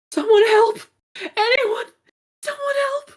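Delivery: very high, anguished cries for help; wailing. Acted. Gecko crying for help outside town, wailing. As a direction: wail desperately for anyone to come. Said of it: "wailing, crying in pain".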